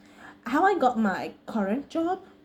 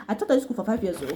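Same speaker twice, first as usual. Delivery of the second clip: lightly mocking a broken-English teacher accent; a mild, clumsy broken English; much faster than usual. Vlogger mocking teachers who go for broken English, a lightly imitated broken accent. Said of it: "the school wants the American accent".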